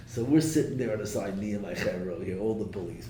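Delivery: ordinary delivery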